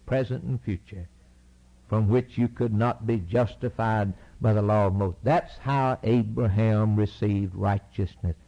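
normal talking voice

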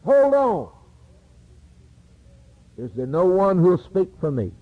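high, loud panicked cry of the condemned; a sinner's alarmed protest; desperate plea. Acted. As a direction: plead frantically at the judgment seat. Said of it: "You say".

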